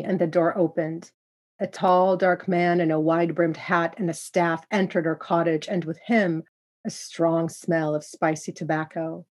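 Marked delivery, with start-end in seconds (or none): none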